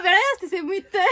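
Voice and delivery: ordinary talk, nothing marked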